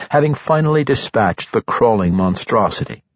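ordinary delivery, nothing marked

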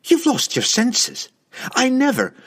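high-pitched outraged woman's voice; shrieked protest. A narrator's reading. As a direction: shriek the denial, voice way up. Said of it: "she shrieked".

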